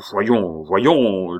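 object, pushed past patience; beside himself. A narrator's reading. Pitou stung by the accusation, exasperated. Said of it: "jeté hors de lui-même".

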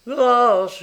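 high, loud wail for mercy; moaned plea. A narrator's reading. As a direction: moan it in terror. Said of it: "gémit-il".

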